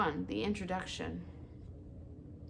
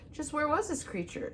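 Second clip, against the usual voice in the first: high, sharp fox question; 9 semitones higher than usual. A narrator's reading. Fox asking the owl, higher. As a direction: ask it higher, pressing. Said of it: "said Fink the fox".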